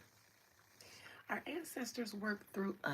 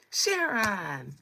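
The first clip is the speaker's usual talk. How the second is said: loud, drawn-out calling-to-account; mock indignant scolding; 9 dB louder than usual. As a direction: call the server out loudly, in mock outrage. Acted scolding of server over gravy.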